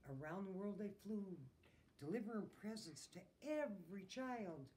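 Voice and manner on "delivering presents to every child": ordinary delivery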